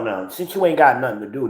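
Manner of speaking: ordinary talk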